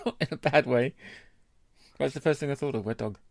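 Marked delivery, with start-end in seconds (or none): none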